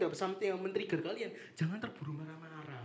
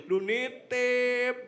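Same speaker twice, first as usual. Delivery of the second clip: louder, very slow, drawn-out "Lu nitip"; same friend's reproach, slower and more exaggerated; exaggerated mock complaint; 5 semitones higher, 9 dB louder, much slower than usual. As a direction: ham up the nagging friend even more.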